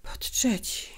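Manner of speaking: whispered weary echo; tired, broken voice. A narrator's reading. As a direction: repeat it faintly, almost without voice. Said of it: "powtórzyła matka".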